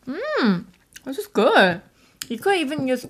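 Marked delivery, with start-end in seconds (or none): 0.0-1.8 tasty yum mmm. Real, not acted. Tasting sweet potato.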